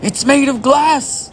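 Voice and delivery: high frightened stammer; panicked shout. A narrator's reading. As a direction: sputter out the fear of an old man. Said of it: "shouted Grandpa Joe".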